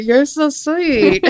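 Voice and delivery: mock muttered, gritted teeth; muttered through gritted teeth. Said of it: "I kinda muttered it".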